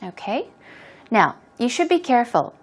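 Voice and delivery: ordinary talk, nothing marked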